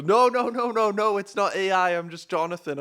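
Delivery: high, loud, eager denial; imitating the caller. The caller insists he is not AI, high and loud. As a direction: squeak out the denial like the flustered caller.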